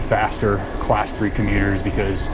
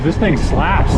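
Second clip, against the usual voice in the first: playful slang; 4 semitones higher, 4 dB louder, slower than usual. Real, not acted. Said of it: "the word of the youth".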